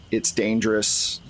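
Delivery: normal talking voice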